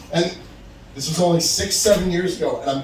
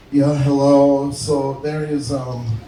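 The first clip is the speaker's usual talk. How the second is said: put-on slow, drawn-out drunk phone voice; drunk or accented; 3 semitones lower, slower than usual. Pizza manager calls back about the driver, slow and drunk-sounding. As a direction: drag the words out like a tipsy pizza worker. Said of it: "if the guy's drunk or he has an accent. That's why I'm talking like that".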